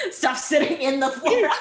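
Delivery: high, loud, through laughter; laughing hard. Real, not acted. Guest teasing host about his on-set line, laughing hard. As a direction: crack up while quoting it back. Cracks up mocking the director's line.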